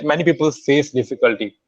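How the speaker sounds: ordinary talk, nothing marked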